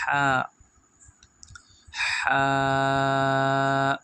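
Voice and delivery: "ہا" as a strong pharyngeal h, long vowel; from the throat. Shown as a demonstration. Teacher showing the letter comes from the throat, long throaty h. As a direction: push "ہا" out from mid-throat and hold it. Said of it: "تو حلق میں سے نکلے گی".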